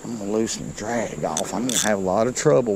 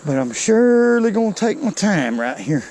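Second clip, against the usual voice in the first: excited; 8 semitones higher, 5 dB louder than usual. Real, not acted. Fighting a big fish.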